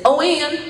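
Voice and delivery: "Oh, Ann" with the J sound dropped from "John"; little brother who can't say J calling "Oh, Ann"; lisping little brother. Retold or imitated. Six-year-old brother leaning over to his big brother, saying "Oh, Ann" without the J. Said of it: "he couldn't say the J sound".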